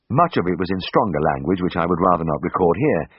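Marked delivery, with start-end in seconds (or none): none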